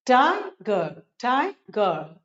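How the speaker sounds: "टाइगर" broken into tai, pause, gar; split syllables. Shown as a demonstration. Teacher drilling two-syllable words, "टाइगर" split as tai - gar. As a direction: say each "टाइगर" in two separate beats. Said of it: "दो syllable से बने हैं".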